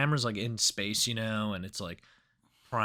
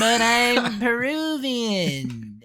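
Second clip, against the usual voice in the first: high, loud, slow hammed-up old man; mock old man; 11 semitones higher, 5 dB louder, much slower than usual. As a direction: drag the words out like a doddering grandpa.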